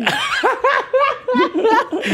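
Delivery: long high-pitched laughing fit; amused laugh. Real, not acted. Host laughing at guest's line about his own parents, high laughter.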